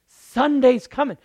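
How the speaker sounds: triumphant call; loud Sunday's coming. Shown as a demonstration. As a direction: shout the promise. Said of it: "repeat loud".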